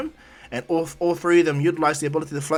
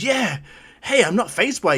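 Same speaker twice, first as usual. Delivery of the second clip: high, raised, lightly cocky reply; upbeat assurance; 6 semitones higher, 4 dB louder than usual. Acted. Dark Shadow unfazed by light, upbeat assurance. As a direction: brush it off brightly.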